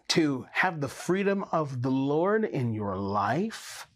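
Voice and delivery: usual speaking voice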